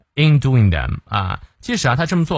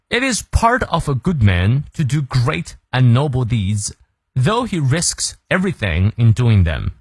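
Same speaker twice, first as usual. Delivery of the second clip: slow model reading. Shown as a demonstration. Teacher reading the day's quote to listeners, slow and clear. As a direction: read the quote slowly and evenly. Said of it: "他说".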